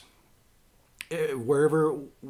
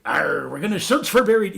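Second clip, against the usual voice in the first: loud, higher pirate growl; 6 semitones higher, 9 dB louder than usual. Retold or imitated. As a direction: ham it up like a storybook pirate. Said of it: "so I'm doing pirate voices".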